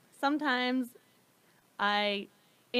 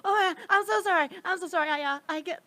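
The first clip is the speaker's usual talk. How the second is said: embarrassed apology; 7 semitones higher, 4 dB louder than usual. Retold or imitated.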